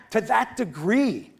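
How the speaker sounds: ordinary talk, nothing marked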